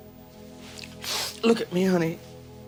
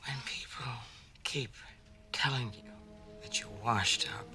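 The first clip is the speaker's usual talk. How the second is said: hushed confiding; 15 dB quieter than usual. Acted. Confiding monologue on being washed up.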